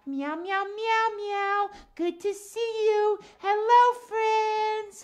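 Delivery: singsong puppet-cat meows and hello; lilting cat voice, words drawn out; cat greeting. Acted. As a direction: greet the children as a musical cat.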